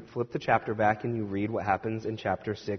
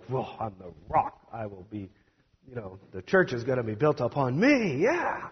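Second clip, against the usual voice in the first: a lightly voiced, hushed Peter in disbelief; awestruck Peter. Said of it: "Peter must just feel like".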